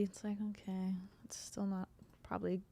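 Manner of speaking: unvoiced mutter under breath; whispered aside. Real, not acted. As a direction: trail off in a whisper.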